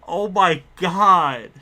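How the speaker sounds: slow, loud, choked-up "oh my god"; overwhelmed, near tears, drawn out; real emotional gasp; overcome with emotion. Real, not acted. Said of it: "I got a little emotional here".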